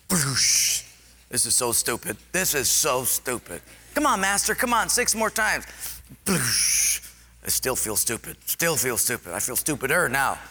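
sulky grumbling. Retold or imitated. Grumbling while dipping.

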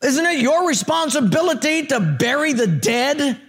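high, indignant mock scolding; belligerent mayor. Mayor challenging the pastor's duty, high mock indignation. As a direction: lecture him as an acted irate official. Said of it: "He's always yelling at people".

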